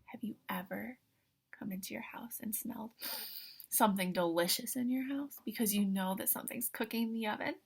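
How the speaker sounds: very soft unvoiced whisper. Real, not acted. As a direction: draw them in with a hush.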